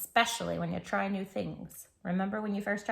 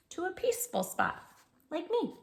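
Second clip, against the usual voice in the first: sweet high spot; 6 semitones higher than usual. Acted. Peaceful spot voice, laugh.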